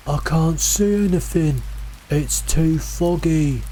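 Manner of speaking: high, drawn-out distant call; calling out, lost. A narrator's reading. Lost voice to searching hikers, plaintive call. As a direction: call plaintively through fog, stretching the words.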